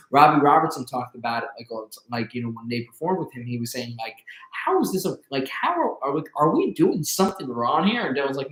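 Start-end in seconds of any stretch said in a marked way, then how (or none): 4.5-8.1 higher-pitched imitation of a baffled bandmate; lightly acted worried questioning, voice pitched up; puzzled questioning. Retold bandmate questioning [name], puzzled.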